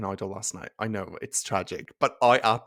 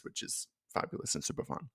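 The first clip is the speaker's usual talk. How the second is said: near-whispered, much softer aside; hushed aside; 12 dB quieter than usual. Real, not acted. Host joking to listeners about life experiences, hushed aside. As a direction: drop to a breath, almost unvoiced.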